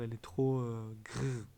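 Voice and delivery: ordinary talk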